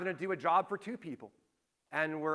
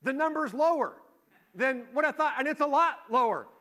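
mock shocked daughter; 7 semitones higher, 4 dB louder than usual. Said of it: "she's like".

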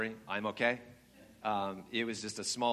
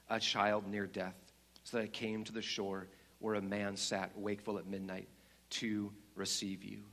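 lion's large deep voice; 5 semitones lower, 5 dB quieter than usual. Acted. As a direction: reveal each deed gently and gravely. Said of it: "the voice continued".

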